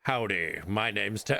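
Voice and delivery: tip your hat and greet them like a cowboy. Pretend. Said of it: "why he's a cowboy".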